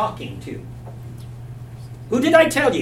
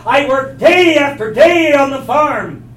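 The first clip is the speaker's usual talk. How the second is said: resentful complaint; 6 semitones higher, 5 dB louder than usual. Acted. Older brother's angry complaint.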